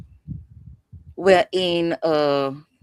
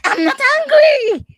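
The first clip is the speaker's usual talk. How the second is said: much higher, much louder protesting shout; indignant denial; 10 dB louder than usual. Retold or imitated. A woman told to stop being angry, shouting back indignantly. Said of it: "I'm like".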